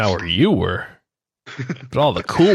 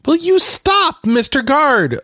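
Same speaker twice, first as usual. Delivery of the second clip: higher, slow teasing rebuke; higher, drawn-out playful reprimand; mock scolding; 14 semitones higher, 4 dB louder, slower than usual. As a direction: tease him like a stern hall monitor. Teasing rebuke amid laughter.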